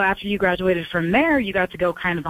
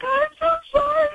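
tearful apology. Retold or imitated. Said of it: "I started just bawling".

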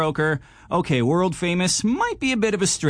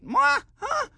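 squeaky shocked disbelief. Retold or imitated. Retelling checking the account, high squeal of disbelief. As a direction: pitch leaps much higher on "what? Huh?". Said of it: "you check it and you're like".

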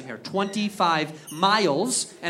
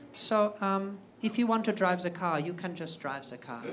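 timid rep voice; 9 dB quieter than usual. Retold or imitated. Timid German car representative.

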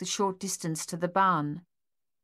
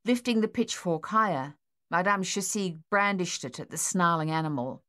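usual speaking voice

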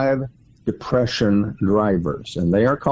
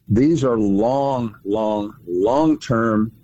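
drawn-out emphasis; much slower than usual. Real, not acted. Stretching repeated long for emphasis.

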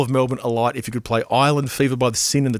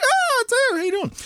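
very high, squealed mock hello; retold excited greeting. Host greets friend on the road, retold high squeal. Retells own delighted reply.

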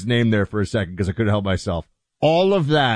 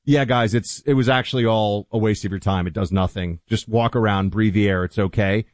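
mock Fauci admission; 7 semitones lower than usual. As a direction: lightly voice Fauci owning up. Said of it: "he could give one public statement to say".

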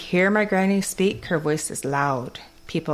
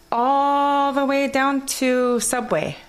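put-on high, slow Kwakwala-accented English; 9 semitones higher, 3 dB louder, slower than usual. Telling a friend her plans in a slow, high Kwakwala accent. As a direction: stretch it out in a Kwakwala lilt. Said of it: "my accent from Kwakwala goes into English".

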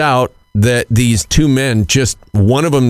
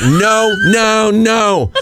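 higher-pitched mock yell, level barely up; retold yelling; 8 semitones higher, much slower than usual. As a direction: refuse him, half-hearted shout. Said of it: "I keep yelling at them".

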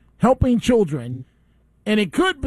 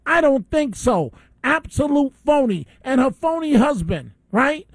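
getting worked up; 4 semitones higher than usual. Real, not acted. Radio host raging at the mayor's wife, heated shout. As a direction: let the anger boil over at her. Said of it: "Now I'm getting worked up".

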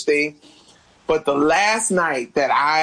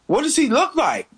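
alarmed question; 12 semitones higher than usual. Retold or imitated. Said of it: "And I was like".

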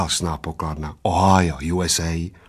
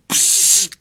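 drawn hiss of breath; hissed psst. A narrator's reading. Little man lets out his held breath, hissing. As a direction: let air hiss out. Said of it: "udělal maličký".

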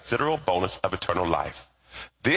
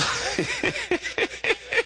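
breathy high-pitched laugh. Real, not acted. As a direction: laugh lightly at yourself. Preacher laughs at himself.

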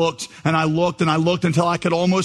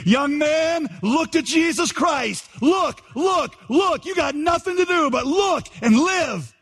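high-pitched Methodist revival cry; preacher's shout; 13 semitones higher, slower than usual. Acted. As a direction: cry it out high, stretching the words. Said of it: "he shouted, as only a primitive Methodist could do".

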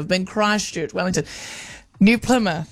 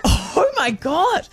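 laughing disbelief; 11 semitones higher, much slower than usual. Retold or imitated. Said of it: "And I was like".

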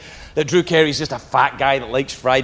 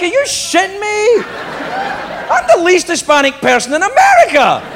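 incredulous outburst; 7 semitones higher than usual. Retold or imitated. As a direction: squeal it in outraged disbelief. Outraged reply to his agent.

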